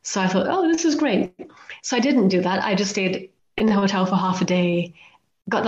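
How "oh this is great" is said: mock delight. Retold thought on robbery warning.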